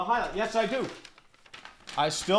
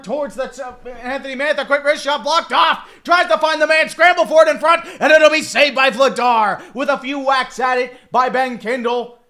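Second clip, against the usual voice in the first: loud, high, rapid hockey call; live play-by-play; 6 semitones higher, 10 dB louder than usual. Acted. Streamer calling a hockey scramble for his viewers, excited play-by-play. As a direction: call the scramble in front like a broadcaster.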